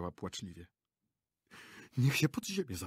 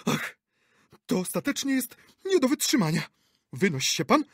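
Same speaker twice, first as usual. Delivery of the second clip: high, loud fed-up shout; exasperated outburst; 13 semitones higher, 6 dB louder than usual. A narrator's reading. Actress to director, exasperated shout. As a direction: lose patience completely.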